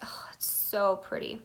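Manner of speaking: breathy hushed admiration; whispering. Real, not acted. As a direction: whisper it in awe. Admiring swatch up close.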